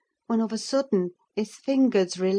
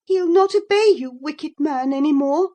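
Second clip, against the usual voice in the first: high, loud defiance; defiant girl; 8 semitones higher, 7 dB louder than usual. A narrator's reading. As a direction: shout her defiance. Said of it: "said Catherine".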